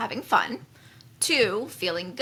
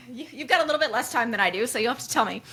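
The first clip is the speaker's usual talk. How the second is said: quick, higher, laughing voice; amused teasing; 4 semitones higher, much faster than usual. Real, not acted. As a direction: tease her through real laughter. Teasing co-host amid shared laughter.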